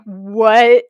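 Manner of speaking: loud disbelieving what; a jolt of disbelief; shocked. Retold or imitated. Shocked at the spilled drink.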